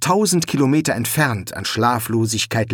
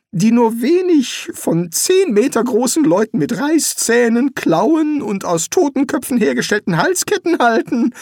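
high-pitched wizard making excuses; hesitant, raised character voice; nervous Rincewind; 12 semitones higher than usual. Acted. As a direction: squirm out of it politely, pitch up. Rincewind awkwardly refuses the statue.

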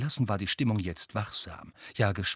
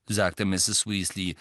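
plain reading voice

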